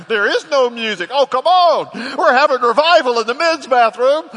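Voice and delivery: hammed-up back-and-forth with his wife; loud laughing retold squabble; mock argument. Playful argument over music.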